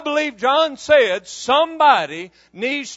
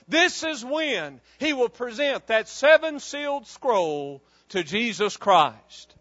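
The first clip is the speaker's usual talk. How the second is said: ordinary delivery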